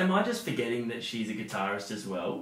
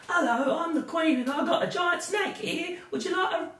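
very high British queen voice; hammed-up falsetto British royal. Host as the Queen offering her giant snake, high British accent. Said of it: "I thought you were gonna go British".

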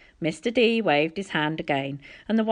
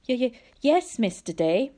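scared stutter; 6 semitones higher than usual. A narrator's reading.

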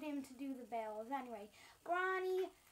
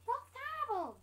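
high squeaky pretend voice, falling; shocked neighbour; 9 semitones higher, 4 dB louder than usual. Neighbour hearing of the move, high shocked voice. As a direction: exclaim it high in mock shock.

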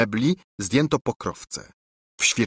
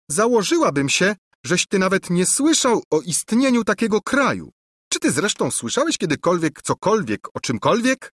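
high, loud taunting princess; a princess jeering at her cousin's ignorance; 9 semitones higher, 3 dB louder than usual. A narrator's reading. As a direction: ridicule him as the haughty princess.

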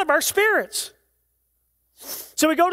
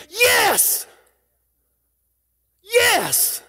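screamed yes; 8 semitones higher than usual. Acted. Preacher voices a spirit screaming its response to truth, exultant shout. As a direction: cry out YES as the spirit's answer. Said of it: "your spirit would scream".

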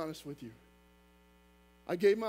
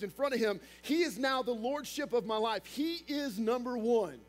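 higher-pitched preaching voice for Joshua's vow; raised pulpit voice for Joshua; 5 semitones higher than usual. Acted.

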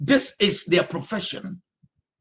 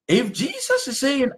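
ordinary delivery

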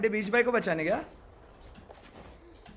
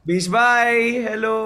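loud, drawn-out hail to a teammate; calling out; 3 semitones higher, 7 dB louder, much slower than usual. Real, not acted. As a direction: call across to your buddy. (ज़ोर से पुकारते हुए)

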